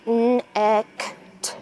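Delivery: letter sounds said one by one; sounding out letters. Shown as a demonstration. Teacher sounding out the letters of "net" for students to write. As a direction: sound out each letter slowly. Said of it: "Sound and write".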